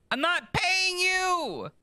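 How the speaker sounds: very high, loud, slow indignant shriek; shrieked refusal. Acted. Asked for money by the priest, shrieking a refusal.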